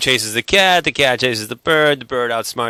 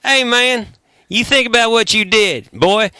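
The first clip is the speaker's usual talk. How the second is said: high hammed-up country accent scold; Southern scolding; 10 semitones higher than usual. Bandmate playing a Southern dad scolding a boy. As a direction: wag a finger, country style. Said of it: "You got authentic".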